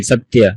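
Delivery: সত্য repeated as "satiya", with dental s; Sanskrit pronunciation. Shown as a demonstration. Teacher repeats for students, সত্য as "sat-ya". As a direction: give সত্য an audible y glide. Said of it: "আমরা সত্য বলি".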